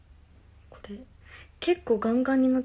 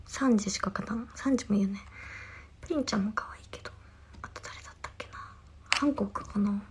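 whisper; 7 dB quieter, faster than usual. Real, not acted. Whispered aside listing favorites.

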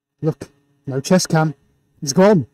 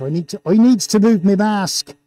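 put-on playful broad Gloucestershire accent; 3 semitones higher than usual. Host needs to shift their mask, hammed-up Gloucestershire accent. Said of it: "best Gloucestershire accent".

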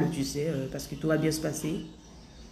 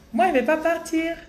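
put-on high-pitched playful Gabonese accent; mock Gabonese French lilt; 11 semitones higher, 3 dB louder than usual. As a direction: mimic a Gabonese speaker, pitched up. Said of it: "Je fais l'accent gabonais".

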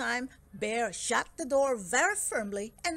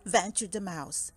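ordinary delivery

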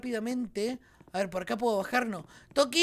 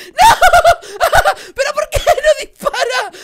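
high, loud shrieking laugh through the words; laugh-scream in real panic; panicked laughing shout; 13 semitones higher, 15 dB louder, much slower than usual. Real, not acted. Gamer missing shots at Granny, shrieking with laughter.

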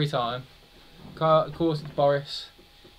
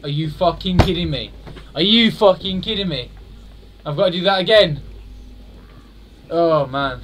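loud, higher angry outburst; furious disbelief; 4 semitones higher, 8 dB louder than usual. Real, not acted. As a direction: rage at the game. Furious at failing the jump.

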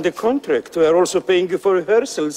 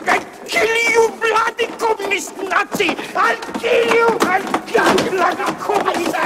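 much higher, shouted threats; furious rant; 13 semitones higher than usual. Acted. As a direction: lose control and yell the threats. Conductor rants in rage.

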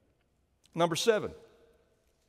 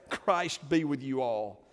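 ordinary talk

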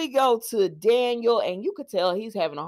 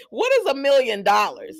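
incredulous question through laughter, louder; laughing; 3 semitones higher, 5 dB louder than usual. Real, not acted. Vlogger scoffing at a million-dollar offer, laughing. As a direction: laugh as you scoff at the sum.

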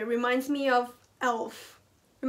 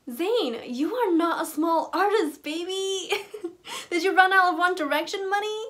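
high, laughing tease at Zayn; teasing laugh; 6 semitones higher than usual. Real, not acted. Laughing while teasing Zayn about his money. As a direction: scold him through giggles.

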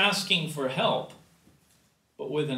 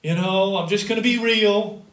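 defiant candor; 4 semitones higher, 8 dB louder than usual. Pretend. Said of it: "He doesn't say".

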